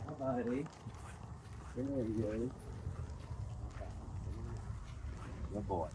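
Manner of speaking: gentle, hushed greeting to an animal; soft pet talk. Real, not acted. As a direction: coax the goat quietly. Softly greeting a goat.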